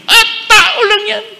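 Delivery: high, loud, laughing sneer; scoffing laugh. Retold or imitated. Bishop mimicking a scoffer dismissing priests, laughing high.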